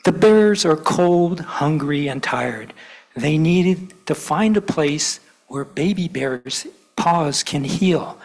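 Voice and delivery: put-on lively read-aloud for kids at church; storytelling to children. A narrator's reading. Minister reads to gathered children, animated storytelling. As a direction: tell it like a picture book.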